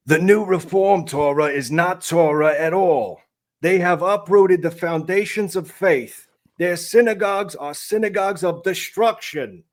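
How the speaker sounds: Reformed rabbi voice. Retold or imitated. Reading a rabbi's quote in a mock Reformed rabbi voice. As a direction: quote the rabbi with lofty indignation. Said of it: "That's my Reformed accent".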